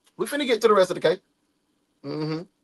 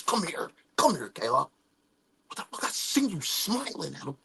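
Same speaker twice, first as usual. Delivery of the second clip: low, hushed mock jealous boyfriend; 6 semitones lower, 13 dB quieter than usual. Cody cornering Kayla about Harvey, low and hushed. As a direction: grumble it like a possessive guy.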